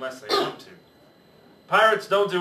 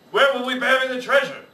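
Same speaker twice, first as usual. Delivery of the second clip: worried pirate; 8 semitones higher, 6 dB louder than usual. Acted. Captain worrying in storm.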